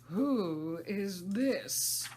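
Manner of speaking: loud, slow and stern; severe queen. Acted. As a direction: demand it like a haughty queen. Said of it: "the Queen said severely".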